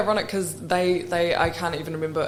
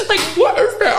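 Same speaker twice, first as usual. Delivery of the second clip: high laughing squeal, much higher; 9 dB louder than usual. Real, not acted. Recalling her cheeky DM to the station, laughing squeal. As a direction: laugh it out in disbelief. Retelling DM to RDU.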